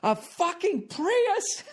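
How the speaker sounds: slow, high, drawn-out mock scream; hammed-up disgusted yell. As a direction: stretch it out like an outraged heckler. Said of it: "She started yelling at me about my car".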